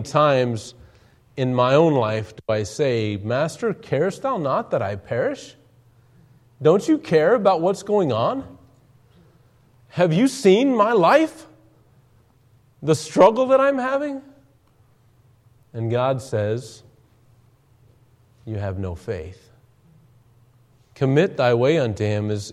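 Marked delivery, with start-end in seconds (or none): 3.2-14.2 plaintive complaint. Retold or imitated. Preacher voices his own complaint. 18.4-19.5 much softer, lower, gentle rebuke; quiet rebuke. Acted. God answering the complaining believer, a soft low rebuke.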